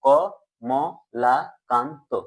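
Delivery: "ক, ম, লা, কান, তো" evenly separated; syllable by syllable. Shown as a demonstration. Teacher recapping what we pronounced, "ক, ম, লা, কান, তো" in separate beats. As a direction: sound out "ক, ম, লা, কান, তো" with pauses. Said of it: "তাহলে কি উচ্চারণ করলাম?".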